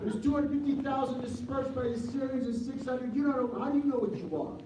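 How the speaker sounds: high, insistent mother; mother's insistence. Retold or imitated. Mother lecturing her young son, high and insistent. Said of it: "She says".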